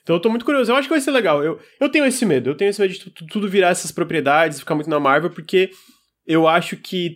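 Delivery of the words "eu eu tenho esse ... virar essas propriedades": ordinary delivery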